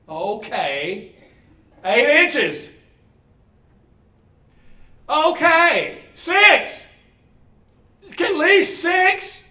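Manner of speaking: high, loud haggling, slowing at the end; boy haggling with God over inches of snow; bargaining. Retold or imitated. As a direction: bargain down, getting desperate. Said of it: "And he's saying".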